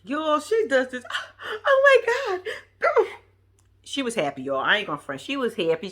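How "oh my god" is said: high, drawn-out gasp of disbelief; squeaky imitated astonishment; mock overwhelmed shock. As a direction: imitate a stunned bride-to-be, slowly. Acts out her reaction to proposal.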